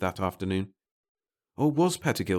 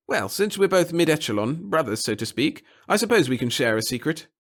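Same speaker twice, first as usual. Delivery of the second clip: raised-pitch character voices for the officials; voiced officials; 8 semitones higher, 5 dB louder than usual. Officials swapping secrets in lifted character voices. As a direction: play both bureaucrats' dialogue in lifted voices.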